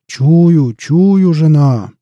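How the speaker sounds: loud sniffing ogre; ogre shouting. A narrator's reading. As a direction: announce the scent loudly. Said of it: "закричал людоед".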